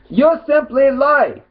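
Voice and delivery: high, loud, slow accusation; angry accusation. Real, not acted. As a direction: spit out the charge slowly. Accusing critic directly.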